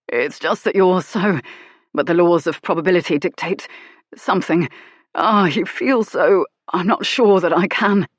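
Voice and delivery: lightly voiced breathless hero; strained lover. Hero overwhelmed mid-lovemaking.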